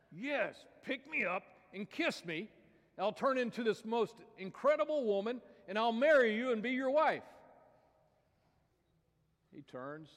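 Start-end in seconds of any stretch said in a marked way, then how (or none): 0.1-7.2 high, loud pleading frog; squeaky frog making a big promise; talking frog. Acted. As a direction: build louder as an eager little frog.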